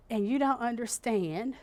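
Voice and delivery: ordinary talk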